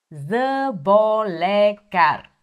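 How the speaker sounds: заболекар drawn out slowly, syllable by syllable; slow for repeating. Shown as a demonstration. Teacher to learners, slowly modelling заболекар. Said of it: "plain take before slow repeat".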